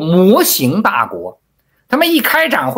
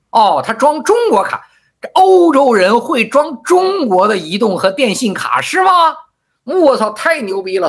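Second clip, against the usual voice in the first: higher-pitched mock amazement; sarcastic; 5 semitones higher than usual. Real, not acted. Speaker ridicules claim of booming phone sales in Europe, sarcastic. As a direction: pretend to be impressed, pitch lifted in sarcasm.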